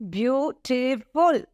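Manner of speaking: Beautiful slowly, beau-ti-ful syllable by syllable; syllables shown slowly. Shown as a demonstration. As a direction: draw Beautiful out so each syllable is counted. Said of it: "three syllables".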